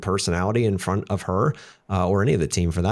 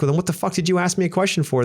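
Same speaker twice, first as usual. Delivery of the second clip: higher, hammed-up irritated outburst; mock exasperated; 9 semitones higher, faster than usual. Husband snapping back at wife, mock exasperated. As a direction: play his pissed-off retort. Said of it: "I'm like".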